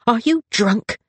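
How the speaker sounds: high, indignant question; demanding. A narrator's reading. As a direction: demand an answer from a younger brother. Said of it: "Honoria demanded".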